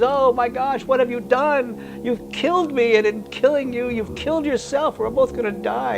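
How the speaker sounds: a frog realising they'll both drown; storyteller's high, shocked dying frog; dying frog's dismay. Acted. Dying frog cries out to scorpion.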